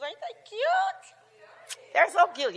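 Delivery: gushing delight. Real, not acted. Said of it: "showing off new shoes".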